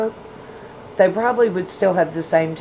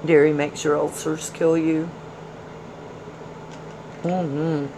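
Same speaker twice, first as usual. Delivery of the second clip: ordinary delivery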